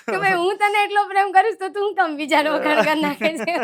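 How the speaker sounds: half-laughing, half-whining jealous plea; laughing mock complaint. Singer mock-complaining to her mother, laughing. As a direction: laugh through a playful grievance.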